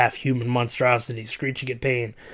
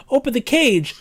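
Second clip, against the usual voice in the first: desperate cry; 12 semitones higher, 5 dB louder than usual. Acted. Trapped girl begging.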